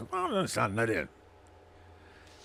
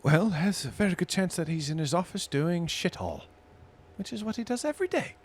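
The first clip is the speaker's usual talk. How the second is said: higher barmaid voice; 9 semitones higher than usual. Acted. Barmaid NPC gossiping.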